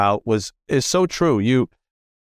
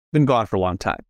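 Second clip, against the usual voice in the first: ordinary delivery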